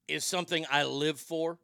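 ordinary talk, nothing marked

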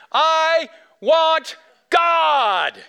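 high, loud, stretched-out shout; loud child's yell. Retold or imitated. A five-year-old answering the ad, loud high yell. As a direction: belt it out like a small boy in a theater. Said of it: "he yelled very loudly".

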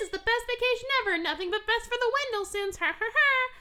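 high, fast and gushing; giddy honeymooner. Retold or imitated. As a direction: squeal it like a smug happy newlywed. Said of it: "and they're like".

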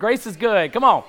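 loud and high, firing up the room; rallying shout. Real, not acted. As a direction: shout it to wake them up. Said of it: "I know you're tired".

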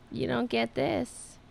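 slow, drawn-out teasing. Pretend. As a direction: tease the cat, drawn out. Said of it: "I'm just teasing him now".

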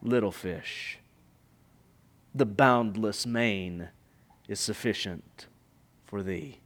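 a vast calm voice answering the little fish; the Father of the waters. Retold or imitated. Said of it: "the Father of the waters ... replied".

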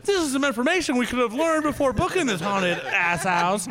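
high-pitched indignant rant; comic outrage. Retold or imitated. Retelling the reply to Travis about the haunted booking, indignant.

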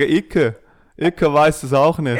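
mock Berlin accent. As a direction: tease her with a slow Berlin Icke. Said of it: "Du musst sagen". (gespielter Berliner Dialekt)

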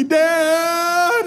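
put-on very high, stretched-out kid whine; imitated whiny scream. Daughter screaming back at dad from upstairs, imitated whiny yell. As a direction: wail for dad like a little girl. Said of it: "in that whiny voice".

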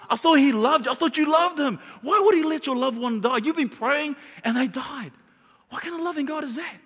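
high-pitched, pressing accusing questions; devil sowing doubt. Acted. Devil to a grieving believer, accusing and doubting. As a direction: challenge the listener with rising incredulity. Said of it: "He would say".

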